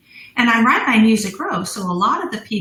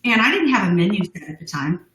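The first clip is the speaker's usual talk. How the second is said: ordinary talk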